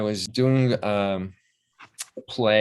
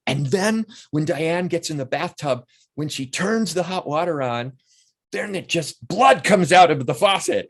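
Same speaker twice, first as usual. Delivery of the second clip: higher-pitched, drawn-out eager brainstorming; excited story pitch; 8 semitones higher, 3 dB louder than usual. Retold or imitated. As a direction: pitch a gory idea excitedly, punching the key words. Excitedly pitching horror ideas, retold.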